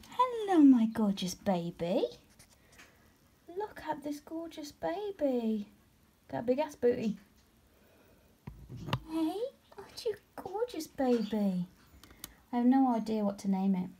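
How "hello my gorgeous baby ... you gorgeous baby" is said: doting pet-voice cooing. Real, not acted. Cooing at new pet spider.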